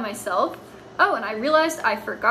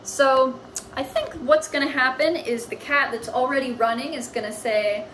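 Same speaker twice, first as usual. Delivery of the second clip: ordinary delivery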